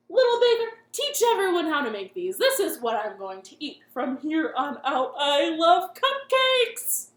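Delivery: loud, high delighted monster king. Acted. Monster king thrilled with the baker's cupcake. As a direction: gush with joy.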